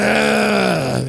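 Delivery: exasperated ah. Retold or imitated. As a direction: blurt it out like you're out of time. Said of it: "it might make you say".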